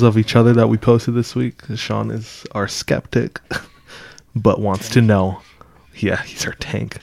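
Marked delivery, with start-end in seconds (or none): 6.0-7.0 unvoiced whispered aside. Real, not acted. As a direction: whisper it to the mic.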